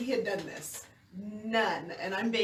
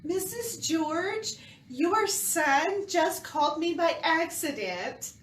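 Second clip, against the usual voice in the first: very slow, higher, measured delivery; concerned lady caller; 6 semitones higher, much slower than usual. Retold or imitated. Lady phoning a boy's supposed mother, slow and careful. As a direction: imitate a caller explaining very deliberately. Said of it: "and the lady's going".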